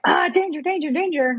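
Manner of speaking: high-pitched mock panic; primal-brain alarm. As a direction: sound a hammed-up danger alarm. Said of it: "my, like, primal brain of".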